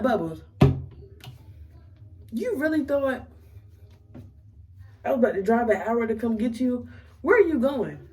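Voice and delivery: refusing to drive an hour; incredulous. Retold or imitated. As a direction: ask it like he's lost his mind.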